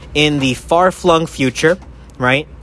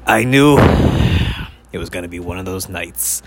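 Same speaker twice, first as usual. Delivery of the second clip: noir detective; 4 semitones lower than usual. Pretend. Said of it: "one of those kind of things".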